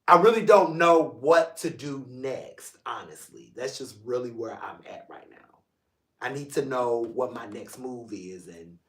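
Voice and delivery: subdued, near tears. Real, not acted. Admits he's holding back tears.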